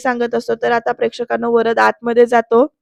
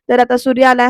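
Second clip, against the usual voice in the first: usual speaking voice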